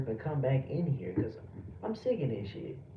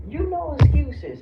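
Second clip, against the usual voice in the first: loud, slow and higher, yelling at the chat; angry shout; 9 semitones higher, 11 dB louder than usual. Real, not acted. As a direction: bark it slowly at the viewer. Angry streamer yelling at chatter.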